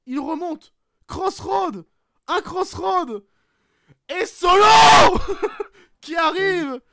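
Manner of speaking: laughing shout, high and loud; cri surexcité. Acted. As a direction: hurle l'arrivée de Solo en riant. Solo interferes, shouting commentary. (cri de commentateur hilare)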